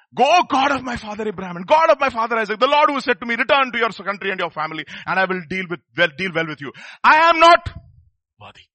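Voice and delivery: loud, ringing scripture reading; preaching at full force. Acted. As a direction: proclaim the prayer to the whole hall. Preacher reads Jacob's prayer aloud.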